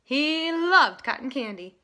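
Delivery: usual speaking voice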